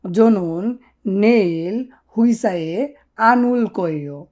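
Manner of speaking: "জনন নেইল হুইসায়ে আনুল কোয়ো" drawn out very slowly; slow Korean model. Shown as a demonstration. Teacher models the Korean answer very slowly. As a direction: dictate the Korean line word by word. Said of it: "কিভাবে বলবেন সেটা?".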